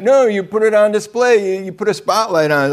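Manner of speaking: ordinary talk, nothing marked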